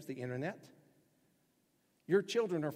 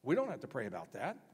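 ordinary delivery

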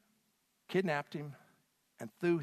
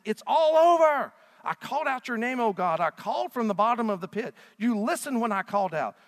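loud, high, impassioned scripture reading building to a cry; booming pulpit delivery, pitch soaring on the cries for rescue; full-force preaching; 9 semitones higher, 9 dB louder than usual. Acted. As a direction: preach it out like a desperate plea from the pit. Preaching the Lamentations passage at full force.

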